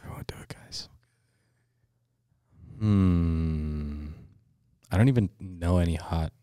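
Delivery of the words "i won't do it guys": very soft, voiceless whisper; whispered promise. Real, not acted. Friend promising co-hosts he will not pick that name, whispered. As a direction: give in under your breath.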